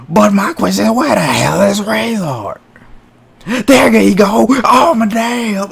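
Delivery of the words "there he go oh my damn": excited shout. Real, not acted. Commentator spotting the behemoth arrive, shouting. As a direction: shout it as the giant storms in. Big man storms in.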